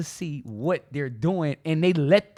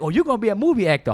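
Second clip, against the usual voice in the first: fast excited teasing; 6 semitones higher, 4 dB louder, much faster than usual. Retold or imitated. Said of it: "People's like".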